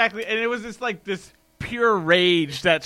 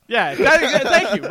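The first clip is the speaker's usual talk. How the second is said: louder, laughing yes; laughing thanks; laughing agreement; 6 semitones higher, 9 dB louder than usual. Real, not acted.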